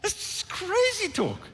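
very high, drawn-out scoffing; incredulous dismissal. Acted. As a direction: play up the disbelief, high and slow. Preacher re-enacts dismissing the lie.

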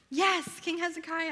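loud, high, celebratory yes; gleeful fangirl outburst; excited cheer. Retold or imitated. Teacher thrilled to preach on King Hezekiah, excited cheer. Cheering her chosen sermon topic.

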